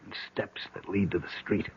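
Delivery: hushed whisper so the guard below won't hear. Acted. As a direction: breathe the words out, no voice. Whispering while escaping guards.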